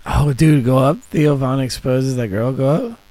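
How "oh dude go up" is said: ordinary delivery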